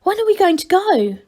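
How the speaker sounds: impatient to set off; eager question. Acted. Angelo eager to leave.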